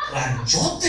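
boastful exaggeration. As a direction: puff the ranch up bigger with a laugh. Friend inflating the ranch in joke.

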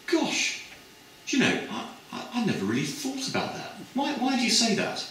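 very high, gasping wonder; a girl struck by a new idea, squeaking; breathless high Gosh of surprise; amazed student. Retold or imitated. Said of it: "this other girl said".

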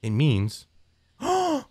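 slow, gasping in disbelief; shocked gasp. Real, not acted. Reading a crude word's meaning, shocked gasp. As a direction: gasp then drag the words out, stunned.

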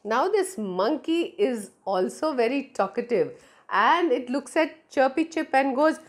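ordinary delivery

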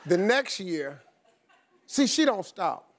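usual speaking voice

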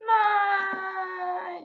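long high shouted no; drawn-out wailing nej; real scream. Real, not acted. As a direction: scream out in frustration. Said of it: "så skriger jeg".

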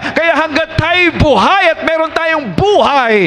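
fervent, rising preacher's call to praise; preaching at full force. Acted. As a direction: drive it home like a revival preacher. Preaching climax. (masiglang pangangaral na pataas ang tinig)

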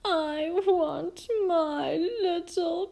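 put-on high, slow, childlike wail; wailing queen. A narrator's reading. Mum missing her little princess, wailing. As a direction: wail it out, stretching every word.

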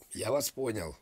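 ordinary talk

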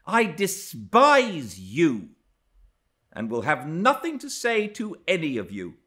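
high, emphatic, haughty tirade; indignant scorn. A narrator's reading. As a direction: reject them all with open contempt. Frowning, scornful rejection of the household.

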